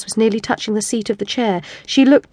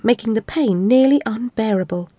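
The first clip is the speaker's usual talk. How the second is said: ordinary delivery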